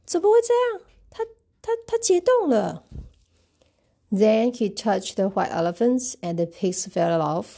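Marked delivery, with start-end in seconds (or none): 0.0-2.8 much higher, stammering in dismay; an elephant finding Elmer frozen, stammering in alarm; shocked and alarmed. Acted. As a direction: stumble over the words in shock.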